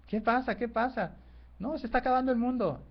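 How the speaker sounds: voz muy aguda de animales alarmados; alarma actuada. Acted. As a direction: sube mucho la voz, como venados en pánico fingido. Venados alarmados preguntan a las liebres. (venados y liebres ante el fin del mundo, alarma actuada)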